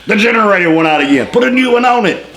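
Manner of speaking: hammed-up imitation of the pilot; mock pilot voice. [name] explaining the broken generator, high put-on voice.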